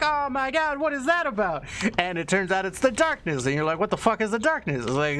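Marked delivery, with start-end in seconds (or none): 0.0-1.7 very high-pitched, hammed-up bewilderment; mock alarm. Acts out reaction to pyramids. 3.8-4.9 high-pitched baffled question; mock bewilderment. Acts out player's bewildered question.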